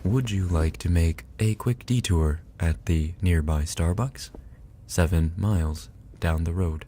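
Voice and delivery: a light, lightly voiced sat-nav read; GPS voice. Friend says he sounds like a GPS, mock sat-nav. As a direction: give directions like a mild navigation voice. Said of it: "You sound like my G P S".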